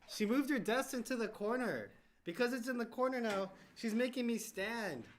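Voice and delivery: loud, high-pitched playful whine; mock complaining. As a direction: protest loudly, drawing out the grievance. Playful loud complaint about having to stand.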